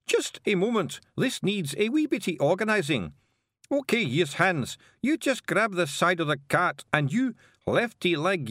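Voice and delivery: high, loud Scots, rising to a yell; bossing the legs into line; Feegle giving orders. Acted. Organising the limbs.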